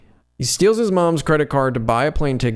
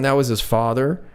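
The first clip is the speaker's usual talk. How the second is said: ordinary talk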